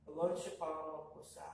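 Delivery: usual speaking voice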